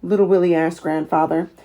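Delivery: ordinary delivery, nothing marked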